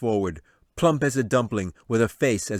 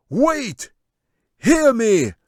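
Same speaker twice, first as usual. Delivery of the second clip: high, much louder shout for attention; wizard shouting; 9 dB louder, much slower than usual. Acted. Wizard shouting over the crowd's uproar. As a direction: silence the uproar. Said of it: "he shouted".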